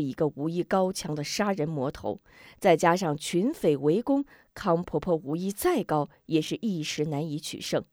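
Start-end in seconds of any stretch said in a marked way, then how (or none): none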